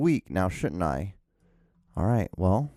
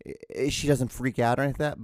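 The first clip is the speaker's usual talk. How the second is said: ordinary talk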